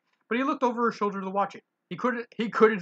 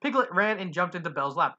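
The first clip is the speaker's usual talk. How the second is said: plain reading voice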